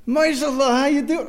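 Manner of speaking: high, slow cheerful hello on the phone; imitating the rabbi's high cheery hello; cheerful rabbi greeting. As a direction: greet him brightly and warmly, drawn out.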